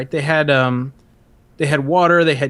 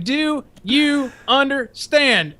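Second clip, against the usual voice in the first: slow, higher, drawn-out demand; drill instructor; 9 semitones higher, much slower than usual. Retold or imitated. Drill instructor demanding an answer, slow and high. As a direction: drag out each word like a sergeant. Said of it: "He's like".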